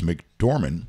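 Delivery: ordinary talk, nothing marked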